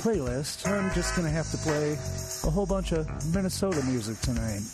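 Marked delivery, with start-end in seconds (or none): none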